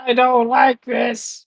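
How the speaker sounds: high, slow little fairy voice. Pretend. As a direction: speak as a tiny fairy. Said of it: "is that your fairy voice?".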